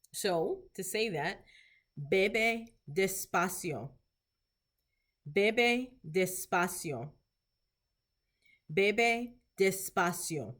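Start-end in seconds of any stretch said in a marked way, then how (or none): 2.1-3.9 very slow, word by word; slow language model. Shown as a demonstration. Teacher modelling Spanish for clinicians, slow and deliberate. 5.3-7.1 very slow, word by word; slow language model. Shown as a demonstration. Teacher modelling Spanish for clinicians, slow and deliberate. 8.7-10.5 very slow, word by word; slow language model. Shown as a demonstration. Teacher modelling Spanish for clinicians, slow and deliberate.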